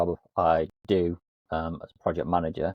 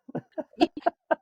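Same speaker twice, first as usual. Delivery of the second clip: squealing high laugh. Real, not acted. Laughing at joke.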